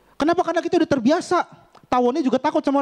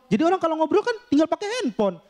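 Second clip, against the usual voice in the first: ordinary delivery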